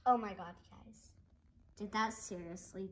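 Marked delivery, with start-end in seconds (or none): none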